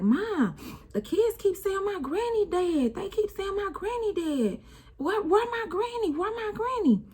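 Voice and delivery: put-on very high little-boy voice, anxious; a child begging to know where his granny is; high child's worried pleading; small son asking. Voicing young son asking for granny.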